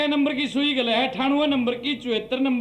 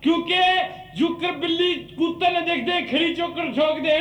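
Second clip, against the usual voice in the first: usual speaking voice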